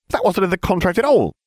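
high, outraged cry; squeaky indignant outburst; indignant ditzy witch. A narrator's reading. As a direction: protest shrilly like the airhead witch. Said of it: "she cried".

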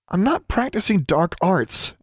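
high, slow indignant retort. A narrator's reading. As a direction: snap back, defensive. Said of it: "Alexandra retorted".

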